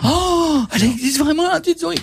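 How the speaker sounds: high gasping wonder; mock amazement. Host pretending to meet the tooth fairy, gasping high with wonder. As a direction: gasp like a child who sees the tooth fairy. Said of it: "le jour où ... je vois ça".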